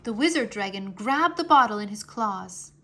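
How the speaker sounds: ordinary delivery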